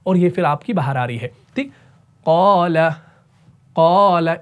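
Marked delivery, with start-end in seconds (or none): 2.2-4.4 thick tajweed reading; heavy reading. Shown as a demonstration. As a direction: say क़ाला heavy, mouth rounded. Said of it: "इसको मोटा पढ़ना है क्योंकि कॉफ है".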